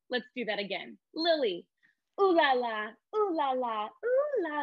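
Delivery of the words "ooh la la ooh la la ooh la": rising silly sing-song, climbing high and slow. Shown as a demonstration. Teacher as Lily, silly sing-song. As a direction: ham it up, shaking your hips. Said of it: "get really silly".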